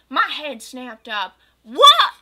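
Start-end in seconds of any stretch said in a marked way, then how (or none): 1.6-2.1 very high, very loud shriek; shocked. Acted. Goth girl shocked her friend says no, shrieking. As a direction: shriek in disbelief.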